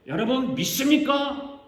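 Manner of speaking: loud, high, slow rallying question; preacher firing up the crowd; revival preacher. Retold or imitated. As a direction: call out to a congregation like a revivalist. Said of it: "어느 목사님이 충청도에서 부흥회를 했대요".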